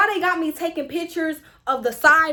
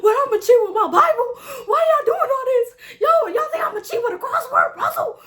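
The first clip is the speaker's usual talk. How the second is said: high, loud, hammed-up rant; irritated outburst; 11 semitones higher, 6 dB louder than usual. Student irritated at proctor's cheating checks, high loud mock tirade. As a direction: wail it in shrieking disbelief. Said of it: "I was already so irritated".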